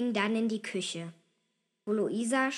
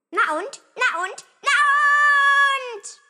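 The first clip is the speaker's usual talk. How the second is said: high, loud, escalating taunt; defiant childish yell; bratty shouting; 12 dB louder than usual. A narrator's reading. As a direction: holler it like a hyper kid. Boy shouts over tired sister.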